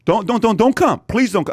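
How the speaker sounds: high, loud pleading, words repeated in a rush of protest; mock frantic pleading. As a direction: imitate a clerk begging someone off. Retold clerk begs him not to come.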